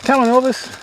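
high, sing-song call to an emu; high coaxing call to a shy emu; coaxing pet call. Real, not acted. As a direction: coax the bird over like calling a pet.

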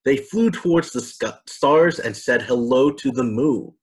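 plain reading voice

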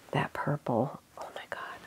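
breathless whispered gush over the yarn; hushed, awed whisper of delight. Real, not acted. Knitter whispering her love for a skein.

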